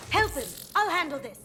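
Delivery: loud, high urgent call; shouted order mid-fight. Acted. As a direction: shout it to a teammate in danger. Urgent battle calls.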